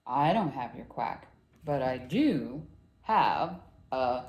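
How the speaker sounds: low, measured cow voice; low storybook cow; cow character. A narrator's reading. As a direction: answer low, drawing out the last words. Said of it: "Little Duckling asked the cow".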